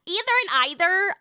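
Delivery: first "Either" as EE-ther, second "either" as EYE-ther. Shown as a demonstration. Comparing with family, "Either and either" said both ways. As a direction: say "Either and either" with two different vowels. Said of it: "I say either one way".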